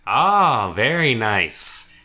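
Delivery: slow, drawn-out acted approval; pleased guard. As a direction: savour the find as the guard. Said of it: "he's like".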